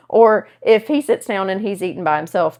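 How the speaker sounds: ordinary delivery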